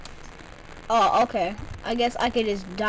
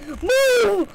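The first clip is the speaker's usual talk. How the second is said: shouting; 8 semitones higher, 4 dB louder than usual. Real, not acted. Shouting at character.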